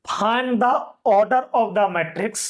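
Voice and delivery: very slow, word by word dictation. Shown as a demonstration. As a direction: dictate slowly for note-taking. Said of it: "अब अगला question". (धीरे-धीरे लिखवाना)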